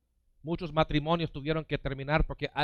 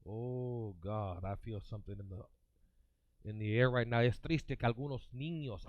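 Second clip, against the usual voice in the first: hushed prayer; 4 semitones lower, 6 dB quieter than usual. Acted. Preacher inviting the congregation to close their eyes, hushed. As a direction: draw them into quiet prayer.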